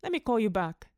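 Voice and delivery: ordinary delivery, nothing marked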